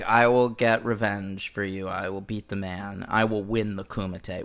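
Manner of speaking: low, soft, earnest promise; mock solemn vow. JCVD promising revenge at the hospital, low and soft. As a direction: keep it hushed and resolute.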